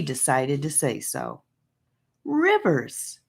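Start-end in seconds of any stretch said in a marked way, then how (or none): 2.2-3.2 high, sharp outburst on the name; a startled angry cry; exploding. A narrator's reading. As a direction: burst out with his name. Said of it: "she exploded".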